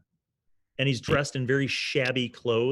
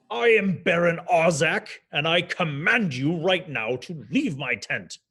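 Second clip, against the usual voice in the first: haughty Baron; 6 semitones higher than usual. Acted. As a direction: order them out with wounded pride. Baron commands them to leave.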